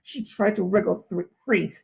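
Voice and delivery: usual speaking voice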